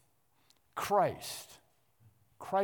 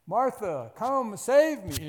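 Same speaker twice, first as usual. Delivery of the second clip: mock plea; 5 semitones higher, 5 dB louder, slower than usual. Stranded driver calling wife.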